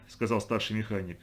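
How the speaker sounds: ordinary delivery, nothing marked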